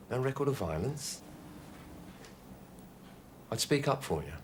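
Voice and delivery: gentle coaxing. Acted. Coaxing gunman to surrender.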